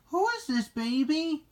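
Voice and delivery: high-pitched nervous question. A narrator's reading. Cleo sees the baby dinosaur, mildly nervous high question. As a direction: ask high, with understated nerves. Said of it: "she asked nervously".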